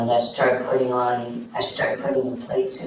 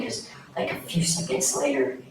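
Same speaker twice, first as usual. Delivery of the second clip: ordinary delivery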